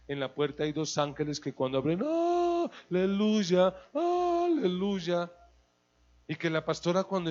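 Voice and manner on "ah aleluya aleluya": high, sing-song hallelujah; breathless holy sing-song; mock angelic greeting. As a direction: ham up heavenly angels welcoming.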